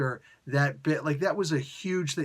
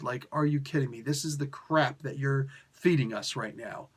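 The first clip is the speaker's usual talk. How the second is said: ordinary talk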